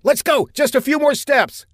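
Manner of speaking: high-pitched urging yell; urgent shout. A narrator's reading. Saffinger urges the woman through the fire, shouting. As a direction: shout encouragement over the roar.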